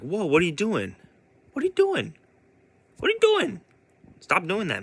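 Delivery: high-pitched dismayed objection; mock alarmed protest. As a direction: imitate a brother stepping in to stop it. Other son confronts torturing brother.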